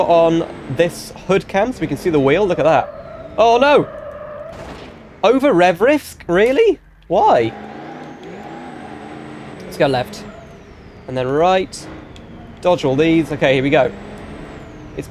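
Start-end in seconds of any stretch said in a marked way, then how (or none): none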